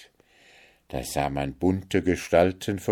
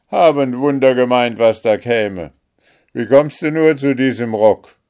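loud, higher mocking; loud scoffing; 5 semitones higher, 9 dB louder than usual. A narrator's reading. Guards ridiculing Märchen's dress, loud scoff. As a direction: sneer at her dress. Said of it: "riefen die Wächter und lachten".

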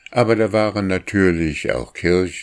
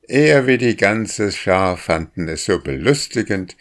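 ordinary delivery

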